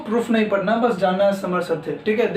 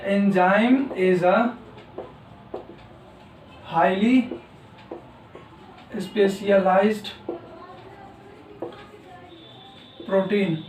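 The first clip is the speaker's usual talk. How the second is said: slow, word by word as he writes; spaced-out dictation pace; 3 dB quieter, much slower than usual. Shown as a demonstration. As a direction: dictate each word slowly while writing. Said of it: "जब तक मैं लिखता हूँ".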